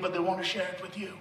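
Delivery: ordinary talk, nothing marked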